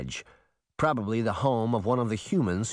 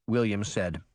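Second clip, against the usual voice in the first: ordinary delivery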